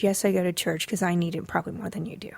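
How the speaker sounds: confiding whisper; whispered aside. Real, not acted. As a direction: lean in and whisper.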